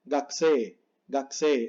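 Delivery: gakusei squeezed to "gaksee"; silent U. Shown as a demonstration. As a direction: drop the U in gakusei, long E ending. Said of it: "no se dice mucho la U".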